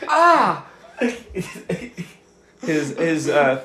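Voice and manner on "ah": much higher, yelping "Ah!"; yelp of pain. Acted. GM voices captive yelping when smacked. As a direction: cry out as the blow lands. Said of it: "He goes".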